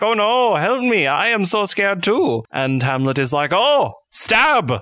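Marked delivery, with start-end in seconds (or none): 0.0-2.4 high, wailing Polonius, hammed up; mock cowardly panic. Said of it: "because he's such a coward". 3.5-4.7 high, sudden comic exclamation; mock battle cry. Acts out Hamlet's stabbing.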